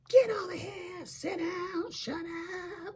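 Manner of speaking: high, mock yelled orders; imitating stern mom. Daughter yelling at her kids, imitated high scolding. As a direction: imitate a mother hollering commands in a high voice. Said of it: "she's like that".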